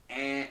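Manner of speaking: "eh" with air through the nose; nasalized vowel. Shown as a demonstration. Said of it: "So instead of a, it's".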